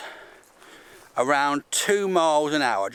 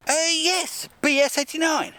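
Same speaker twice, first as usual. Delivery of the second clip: much higher, proud insistence; insistent interviewee; 12 semitones higher than usual. Pretend. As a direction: insist in a squeaky voice. Mock interview with himself.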